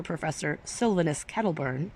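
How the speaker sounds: ordinary delivery, nothing marked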